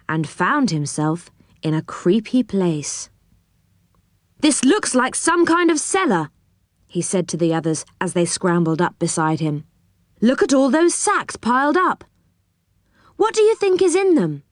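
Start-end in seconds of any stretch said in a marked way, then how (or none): 4.4-6.3 excited discovery. A narrator's reading. Boy exploring a cellar. 10.2-14.4 put-on high-pitched, wondering young adventurer; eager curiosity. A narrator's reading. Joe shows the others the sacks, eagerly curious. Children find mysterious sacks.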